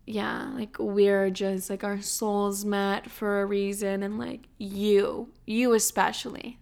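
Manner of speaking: soft and slow, lovey-dovey; mock dreamy soulful talk. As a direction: play the spiritual girlfriend, drawn out. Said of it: "I'm getting all deep".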